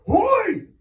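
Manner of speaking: sharp, very high, loud yell; sudden shout. A narrator's reading. Captain suddenly yelling at a sailor, loud and high. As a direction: bark it out of nowhere. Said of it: "he suddenly shouted".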